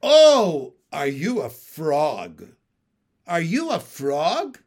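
loud "Oh", then slow, deliberate question on "frog"; astonished, drawn-out loud question; dawning realization. Acted. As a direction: burst out with it, then spell out "frog" slowly. Realizes fog means frog.

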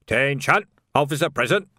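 restrained parade shout. A narrator's reading. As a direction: call the room to attention. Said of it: "and shouted".

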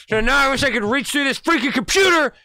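high, loud mock fury; mock rage. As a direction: pretend to want to strangle him. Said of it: "I'm on edge".

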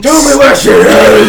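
Batman growl, shouted hard; low gravel vigilante growl. Acted. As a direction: demand it like the caped vigilante.

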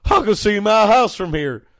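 hillbilly holler, rising to a shout; very high, loud yokel voice; Cletus. Acted. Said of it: "And he goes".

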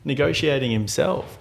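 high-pitched puzzled mimicry; mock incredulity. Car owner wondering about the buyer's mechanic, mock incredulous. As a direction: imitate a baffled seller, pitched well up. Imagined owner questioning the middleman.

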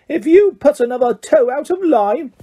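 high, piercing mock-mum voice; shrill mocking mother. A narrator's reading. Malfoy mocking Ron's mother in front of the courtyard, high and piercing. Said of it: "He put on a shrill, piercing voice".

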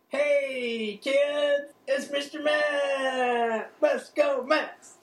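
high, loud cheering mascot voice; Mister Met mascot. Acted. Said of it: "I'm gonna be like".

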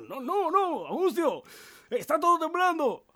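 very high, loud protest; panicked shout. Acted. Mario insisting to housemate on tremor, high panicked yell.

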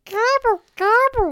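squeaky, loud cartoon gobble; a creepy cartoon turkey; disguised gobble voice. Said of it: "Give us your best disguised gobble voice".

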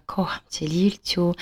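soft retold whisper to the baby. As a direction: hush it like over a sleeping baby. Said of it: "szeptem powiedziała".

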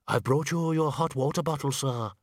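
slightly muffled servant's call. A narrator's reading. Servant brings the bather a hot water bottle, muffled. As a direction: speak as if through a closed door. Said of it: "A muffled voice said".

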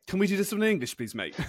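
light Liverpool twang, casual; Scouse accent. Retold or imitated. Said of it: "proper Scouse accent".

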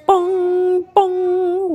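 high, ringing bong bong; clock bong. A narrator's reading. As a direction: chime like a clock. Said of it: "went the clock".

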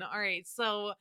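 ordinary talk, nothing marked

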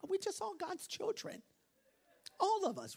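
high sing-song mock sweetness; mock sentimental. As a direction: coo it like a sugary believer. Mocking sentimental view.

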